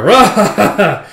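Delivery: loud, high stagey guffaw; acted hearty "ha ha ha"; booming belly laugh. Newcomer laughs at the beaten boys, booming guffaw.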